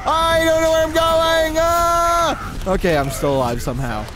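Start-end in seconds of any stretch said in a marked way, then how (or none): 0.0-2.7 panicked screaming. Real, not acted. Gamer fleeing a charging enemy, panicked high yells. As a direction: scream as you flee the charge.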